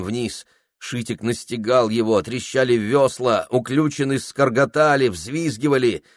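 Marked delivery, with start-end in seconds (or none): none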